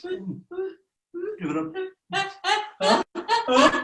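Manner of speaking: high, slow wind-up into a sneeze; build-up to a sneeze. Shown as a demonstration. Teacher acting out a bull's hay-fever sneeze. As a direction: draw in breath like an itchy sneeze coming. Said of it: "We're gonna sneeze our way around the room".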